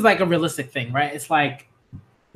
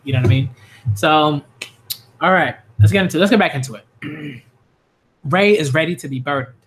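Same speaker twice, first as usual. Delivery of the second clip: usual speaking voice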